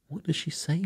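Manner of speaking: lightly acted girl, pitched right up; girl's voice. A narrator's reading. Wendy asks Peter to translate the fairy, curious girl's voice.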